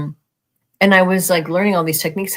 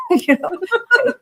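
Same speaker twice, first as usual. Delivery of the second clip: laughing. Real, not acted. Telling a friend about night visitors, laughing hard. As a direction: laugh it out, nearly crying with laughter.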